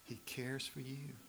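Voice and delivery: slow, whispery soft delivery; hushed reassurance. Real, not acted. Preacher reassures the congregation, in a slow hush. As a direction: let it sink in, softly and slowly. Repeats the verse answer for emphasis.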